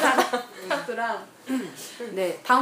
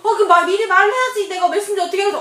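high, loud mock telling-off; hammed-up exasperated scolding; scolding bride; 8 semitones higher, 7 dB louder than usual. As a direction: imitate a bride snapping at her groom. Acting the bride scolding groom.

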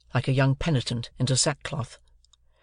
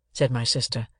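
ordinary delivery

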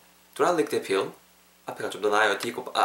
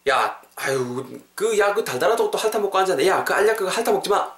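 quick, higher-pitched telling-off; exasperated scolding; 6 semitones higher than usual. Acted. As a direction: fuss at someone licking a pill. Acted scolding in practice dialogue. (답답해서 나무라는 말투)

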